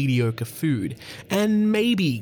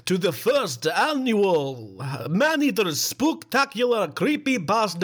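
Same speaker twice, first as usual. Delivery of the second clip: spooky announcer; 6 semitones higher, slower than usual. Acted. Announcing Halloween special.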